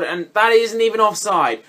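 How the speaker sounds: ordinary delivery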